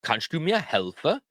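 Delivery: words joined into one question; whole-phrase demo. Shown as a demonstration. Teacher shows learners the whole help question, joined up. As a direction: run it together smoothly, rising at the end. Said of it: "How we say that".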